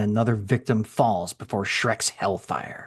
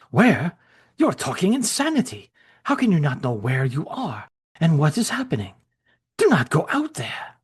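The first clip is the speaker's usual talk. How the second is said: higher alarmed German voice; alarmed friend; 6 semitones higher than usual. Acted. Gerstein warning Shrek not to go outside, alarmed voice. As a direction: warn him off, incredulous.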